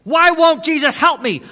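high, loud anguished cry; imitating a frantic man's outburst; desperate shouting. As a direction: shout the question right in someone's face. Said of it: "he got right in my face".

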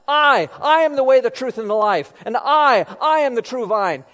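each opening I loud, held and set apart; emphatic I. Retold or imitated. Preacher quoting Jesus's sayings, loud emphatic I. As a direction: hammer I, then declare "I am" in full voice. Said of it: "it's an emphatic kind of expression".

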